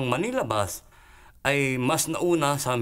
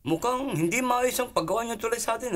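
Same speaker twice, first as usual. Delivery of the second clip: high-pitched, indignant scolding; hammed-up complaint from a cross chairman; angry chairman; 13 semitones higher than usual. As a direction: take the engineer to task in a raised pitch. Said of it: "Galit na galit yung chairman".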